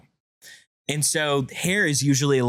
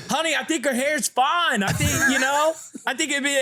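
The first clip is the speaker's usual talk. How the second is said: much higher, appeasing sing-song; placating call; 11 semitones higher than usual. Retold or imitated. Dad calling placatingly to mom about the hairdo, much higher. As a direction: soothe the wife from the next room.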